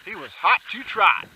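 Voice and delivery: loud, high, thrilled cheering; excited whoop. Real, not acted. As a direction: whoop it up after a hit. Excited after hitting bird.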